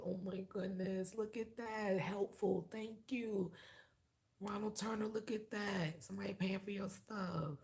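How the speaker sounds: high, soft singsong gratitude; delighted thanks. Real, not acted. Delighted thanks to a helpful listener.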